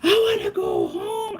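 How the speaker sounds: high, slow sobbing shout; wailing to be taken home; crying shout. Retold or imitated. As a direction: scream it through tears in terror. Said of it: "I'm just screaming my head off".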